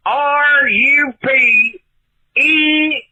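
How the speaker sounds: slow deliberate spelling, each letter apart. Shown as a demonstration.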